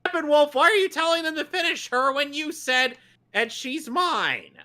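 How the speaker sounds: very high-pitched, indignant rant; exasperated outburst. Real, not acted. Viewer berating Steppenwolf for a muddled order, exasperated high cry. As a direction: wail the objection, stretching the final words. Yells objection at the villain's line.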